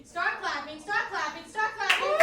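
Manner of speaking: urgent, escalating hype; rallying the crowd. Real, not acted. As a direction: whip the room into clapping. Host whipping up applause.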